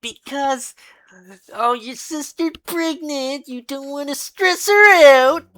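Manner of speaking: a sing-song mock reminder to keep calm; mock voice of reason. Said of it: "I have to really restrain myself".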